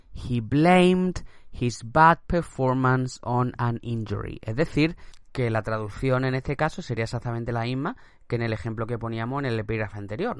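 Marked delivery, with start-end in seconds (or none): 0.1-4.4 slow English repeat; slow dictation read. Shown as a demonstration. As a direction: say it again slowly. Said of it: "teacher repeats example".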